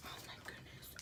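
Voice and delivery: hushed unvoiced whisper; awed whisper to the camera. Real, not acted. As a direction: breathe it softly in awe. Whispers on seeing cards.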